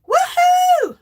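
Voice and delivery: high, loud party whoop; excited cheer. Acted. Host cheering a viewer's readiness to party, excited whoop. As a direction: whoop it up, pitch soaring.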